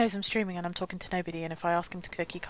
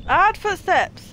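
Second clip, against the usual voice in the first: high, loud and slow alarm; 8 semitones higher, 6 dB louder, slower than usual. Real, not acted. Streamer hiding in hay hears footsteps, alarmed and loud.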